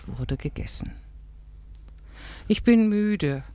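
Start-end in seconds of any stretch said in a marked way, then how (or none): 2.5-3.4 higher-pitched, mildly weary; understated weary plaint; sighed complaint. A narrator's reading. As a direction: sigh it out, a touch plaintive. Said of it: "seufzte Rahel".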